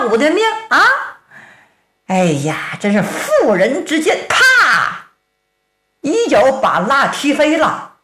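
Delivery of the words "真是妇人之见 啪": plain reading voice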